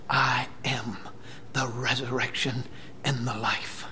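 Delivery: soft, low, measured declaration; hushed retold words of Jesus; solemn declaration. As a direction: say it quietly, with reverent weight. Quotes Jesus to grieving sisters.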